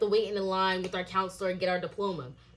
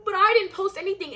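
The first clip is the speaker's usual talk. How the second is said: mildly indignant, pitched up and louder; retold protest of innocence, voice jumping higher; 9 semitones higher, 5 dB louder than usual. Retelling her own protest.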